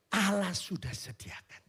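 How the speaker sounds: hushed, slow whisper of awe. Acted. Pastor whispering God's promise to the church, hushed. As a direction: breathe it like a secret to the congregation.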